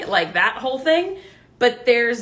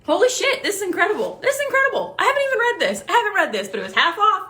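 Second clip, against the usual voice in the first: high-pitched, gushing thrill; so excited; 9 semitones higher, faster than usual. Real, not acted. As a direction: burst out, repeating yourself in high excitement. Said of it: "and I'm so excited".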